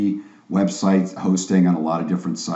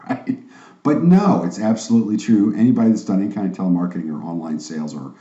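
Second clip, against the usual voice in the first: usual speaking voice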